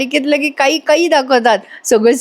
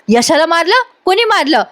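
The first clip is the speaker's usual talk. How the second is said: alarmed question; 6 semitones higher than usual. Acted. Nami alarmed Yasha was hit.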